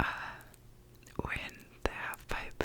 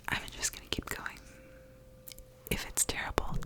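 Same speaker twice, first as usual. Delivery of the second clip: normal talking voice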